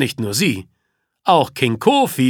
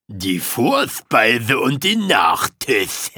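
lower, slow, savouring each word; lightly voiced lion king; 6 semitones lower than usual. A narrator's reading. King Kofi gloating over his prey, low and slow. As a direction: relish the meal ahead.

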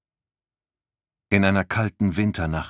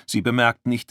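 usual speaking voice

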